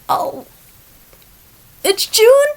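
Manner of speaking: high, loud mock-gushing squeal over rainbows; mocking shallow ally. Vlogger mocks someone who only celebrates in June, squealing. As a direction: gush like a bandwagon ally. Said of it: "that person that just says".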